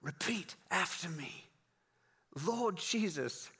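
put-on slow, softened British accent; British pastor murmuring in someone's ear; hushed British prayer. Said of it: "he started whispering".

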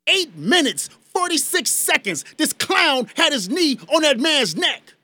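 rising to a real angry shout; angry outburst. Real, not acted. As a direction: let the outrage spill into a shout on the last line. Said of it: "let me calm down".

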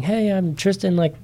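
high, eager self-introduction for a camera; imitating an excited audition tape; put-on excitement. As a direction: act excited, pitching it up and rushing the end. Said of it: "trying to be excited".

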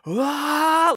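a man's scream, retold; imitated scream. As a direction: imitate a man crying out as he runs. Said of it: "you could hear him go".

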